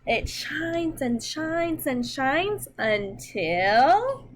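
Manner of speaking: slow, expressive storybook reading; reading to children. Acted. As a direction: read it gently to little kids, taking your time. Reads picture book to children.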